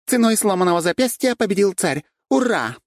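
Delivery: high, loud victory cry; triumphant announcement. A narrator's reading. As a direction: proclaim the champion to the room. Supporter announces the winner, cheering.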